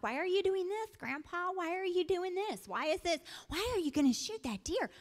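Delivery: put-on high, pleading little-girl voice, repeated whys; imitating little girls. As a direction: mimic a curious kid nagging grandpa. Girls pestering grandpa while hunting.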